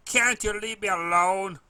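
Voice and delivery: loud, high, upset filly yelling to be left alone; angry shout. A narrator's reading. Upset filly yelling at the pony bothering her, loud angry shout. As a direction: snap at the pony to go away, loud.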